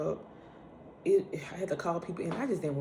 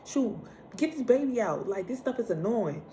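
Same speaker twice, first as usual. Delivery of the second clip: unload your annoyance in your head, pitched up; irritated, not said aloud; 7 semitones higher than usual. Retold or imitated. Woman in labour fed up with chatty doctors, high irritated rant. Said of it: "but I'm like".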